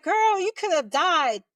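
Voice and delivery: high, loud retold telling-off; imitated friend's scolding. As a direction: scold her like an alarmed best friend.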